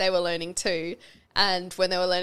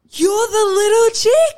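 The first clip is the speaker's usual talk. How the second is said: much higher, louder, slow and amazed; excited recognition; 13 semitones higher, 10 dB louder, slower than usual. Retold or imitated. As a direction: gasp it out in delighted disbelief. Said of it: "She's like".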